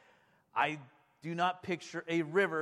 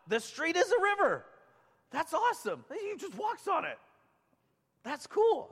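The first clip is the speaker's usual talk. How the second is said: high-pitched, delighted amazement; awestruck excitement; 11 semitones higher than usual. Real, not acted. Preacher marvelling to congregation that the street is a river, excitedly.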